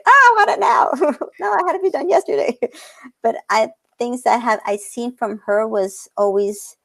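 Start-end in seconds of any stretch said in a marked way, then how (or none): 0.1-2.6 put-on very high, childish whine at her own impatience; mock impatient whine. Mocking her own impatience, high whiny voice. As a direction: whine like a kid who wants it right now. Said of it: "because I want things yesterday".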